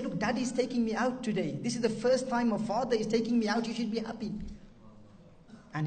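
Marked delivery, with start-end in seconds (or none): 0.0-4.3 higher-pitched retold child's excitement; lightly voiced happy daughter. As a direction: quote a daughter eagerly reassuring her mother.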